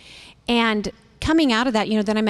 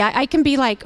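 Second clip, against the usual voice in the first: usual speaking voice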